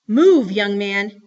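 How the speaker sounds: loud, slow maternal command; stern order. A narrator's reading. Mama orders Kenneth to move, sternly. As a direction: order the boy with weighty anger. Angry mother orders her son.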